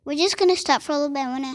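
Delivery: ordinary delivery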